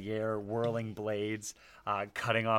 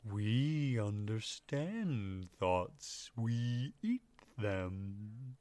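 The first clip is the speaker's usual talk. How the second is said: very slow gentle creature voice; slow sad creature; much slower than usual. Acted. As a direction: drawl out each word sadly. Flump speaking.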